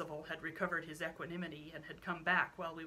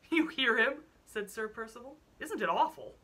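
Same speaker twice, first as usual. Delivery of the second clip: loud mock outrage; 5 semitones higher, 9 dB louder than usual. Sir Percival exclaims mockingly.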